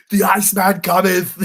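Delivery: loud, booming villain pronouncement; Mr. Freeze voice. Pretend. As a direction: declare it like an icy supervillain. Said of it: "Pretty good impression".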